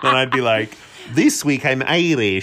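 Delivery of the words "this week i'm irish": put-on slow Irish accent drifting toward Eastern European; bad Irish accent. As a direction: attempt Irish, land near a vampire. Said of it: "That is my Irish accent".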